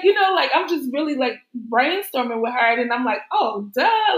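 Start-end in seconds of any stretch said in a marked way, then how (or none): 3.3-4.2 higher-pitched "duh" moment; kicking yourself for missing the obvious; self-mocking realization. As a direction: play a sudden, obvious realization. Acts out her sudden realization.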